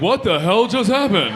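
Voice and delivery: slow, stunned what-the-hell; slow bewildered exclamation; baffled. Retold or imitated. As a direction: act out a dumbfounded guy. Guy's baffled reaction acted out.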